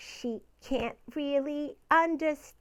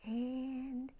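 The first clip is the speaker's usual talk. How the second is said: fading to a whisper; 3 semitones lower, 12 dB quieter, much slower than usual. Acted.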